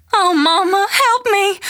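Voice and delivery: high, loud plea for help; crying out. A narrator's reading. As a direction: cry out loud, pitch leaping up. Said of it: "Carrie cried out".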